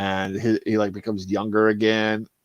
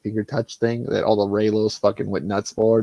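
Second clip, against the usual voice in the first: ordinary talk, nothing marked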